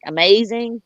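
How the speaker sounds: usual speaking voice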